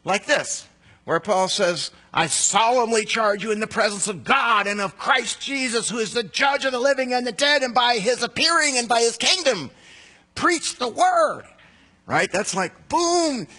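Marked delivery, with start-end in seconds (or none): 2.1-11.4 raised, forceful solemn charge; high, loud, slowing at preach the word; emphatic reading. Retold or imitated. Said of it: "emphatic language". 12.9-13.5 high, punchy boom; emphatic boom. Shown as a demonstration. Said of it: "How much more power can you put on something".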